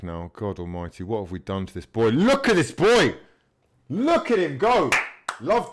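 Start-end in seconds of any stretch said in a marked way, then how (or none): 2.1-5.7 delighted cheer. Real, not acted. Delighted at Pepe's run.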